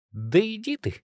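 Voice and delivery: high, exasperated brush-off; annoyed retort. Acted. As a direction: snap it off in irritation. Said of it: "с досадой буркнула под нос".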